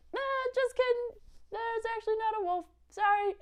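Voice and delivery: put-on high, cheeky kid voice; boy telling the townspeople it was a joke, high and cheeky; teasing boy. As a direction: tease them like a naughty boy. Boy admits the prank.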